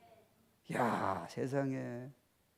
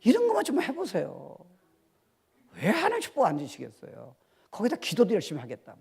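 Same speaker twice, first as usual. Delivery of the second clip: ordinary talk, nothing marked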